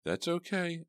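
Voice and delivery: high, shaky reassurance. A narrator's reading. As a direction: try to steady a shaking voice. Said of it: "I said shakily".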